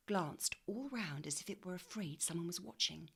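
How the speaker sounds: usual speaking voice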